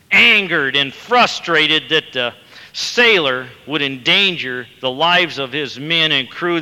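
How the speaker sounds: normal talking voice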